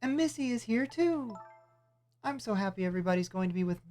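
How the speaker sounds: put-on high kid voice calling a name; high childlike character. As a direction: sing it out like a happy little kid, then call. Voices happy then calling character.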